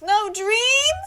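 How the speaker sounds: indignant outburst. Real, not acted. Reacts to skipped dream.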